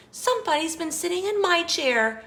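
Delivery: high, quick, sweet exclaiming; Mama Bear's sweet voice. A narrator's reading. Mama Bear sees flattened cushions, sweet high voice. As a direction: exclaim sweetly, pitched well up. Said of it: "in her sweet, low voice".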